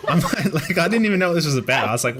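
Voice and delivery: ordinary talk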